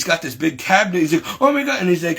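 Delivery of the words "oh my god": high-pitched retold shock. Father seeing the big fallen cabinet, shocked. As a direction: imitate a parent's startled exclamation.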